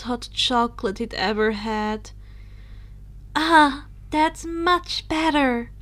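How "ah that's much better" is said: higher, slow relieved sigh of words; relieved exclaim. A narrator's reading. Luna relieved once the nastiness is gone, higher and slow. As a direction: breathe out the relief. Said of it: "Luna exclaimed".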